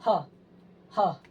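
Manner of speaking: teacher showing the breathy ह; breathy h demo. Shown as a demonstration. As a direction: push warm air out on ह. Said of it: "इस प्रकार से करके देखिएगा".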